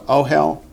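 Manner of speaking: "ohel" accented on the opening "o"; stress on first syllable. Shown as a demonstration. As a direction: stress the front of "ohel", lifting as if listing. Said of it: "The accent shifts from the first syllable to the last".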